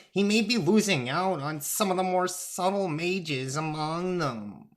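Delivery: high-pitched little god making his case; Thoth, pedantic god. Acted. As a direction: argue a point in a high, lilting character voice.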